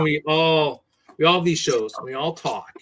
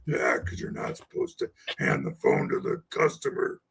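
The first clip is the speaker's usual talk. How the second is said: bad voice; 3 semitones lower, 5 dB quieter than usual. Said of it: "the bad voice".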